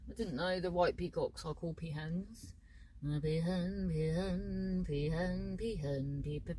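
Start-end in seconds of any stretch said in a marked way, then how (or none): 3.0-6.5 peahen repeated, fading softer and sinking lower each time; playful word chant dying down to a deep, soft end; sinking repetition. Pretend. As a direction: let each peahen drop away until it is a low, faint croak.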